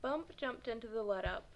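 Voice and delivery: ordinary delivery, nothing marked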